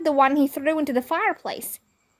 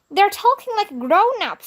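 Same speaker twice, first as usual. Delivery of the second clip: put-on much higher kid's complaint; higher mock kid yell; kid shouting; 8 semitones higher than usual. A narrator's reading. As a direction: call out in protest. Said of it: "some kid shouted".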